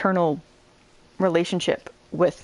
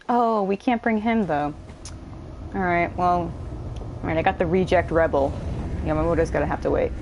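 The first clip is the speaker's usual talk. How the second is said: usual speaking voice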